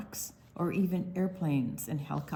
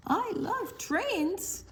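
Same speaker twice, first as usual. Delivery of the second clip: higher and louder, building to the end; excited storybook reading; 7 semitones higher, 4 dB louder, slower than usual. Acted. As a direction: carry the kids to the happy finish. Reads book ending aloud.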